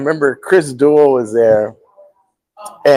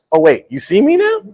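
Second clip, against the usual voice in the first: mildly pointed sarcastic question; sarcastic jab; 6 semitones higher than usual. Retold or imitated. Comedian retelling his jab at Mencia, mild sarcasm. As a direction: needle him a little, half laughing. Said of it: "I go, I go".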